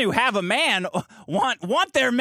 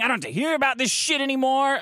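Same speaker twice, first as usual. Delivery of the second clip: higher-pitched mock outburst; imitated shouting; 6 semitones higher than usual. Limo driver shouting in traffic, mock shout. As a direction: retell her fed-up shout, pitched up. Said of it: "she's shouting".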